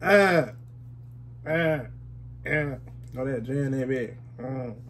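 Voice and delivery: face squished by hand; speaking while rubbing face; hand pressed on cheek. Real, not acted. Seen 3: hand rubs and squeezes cheek; mouth mostly uncovered, partly at times.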